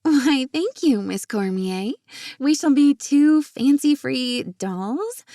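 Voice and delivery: high, loud, slow mock-glamorous voice; old-movie dame. A narrator's reading. Jenna playing along with Marina's old-movie act, mock-glamorous. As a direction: play the fancy doll, drawing it out.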